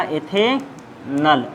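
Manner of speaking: Ethanal dragged out slowly and falling; slow drawn out. Shown as a demonstration. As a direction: stretch each sound of ethanal. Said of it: "क्या हो जाएगा?".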